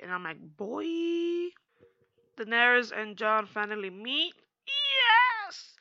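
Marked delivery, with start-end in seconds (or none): 0.4-1.5 put-on high, soft knowing "Boy."; a hushed, amused "Boy.". Recapper reacting to Melisandre's warning, soft high knowing tone. 4.7-5.7 high squeal of delight; excited yes. Real, not acted. Fan cheering as Daenerys and Jon meet, high excited squeal.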